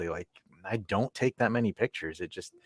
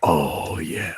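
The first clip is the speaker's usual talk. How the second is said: low, drawn-out mock seductive voice; playful deep voice; put-on deep voice; 4 semitones lower, 4 dB louder than usual. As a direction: drop down deep and croon it. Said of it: "did that voice".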